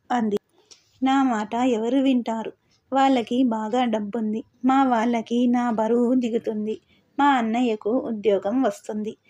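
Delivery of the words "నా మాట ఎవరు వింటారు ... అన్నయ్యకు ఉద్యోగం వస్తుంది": plain reading voice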